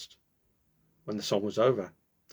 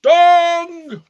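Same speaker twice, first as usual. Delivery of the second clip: long high loud bong; clock chime; 15 dB louder than usual. A narrator's reading. Clock striking midnight, a long loud bong. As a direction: ring it out like a big clock bell.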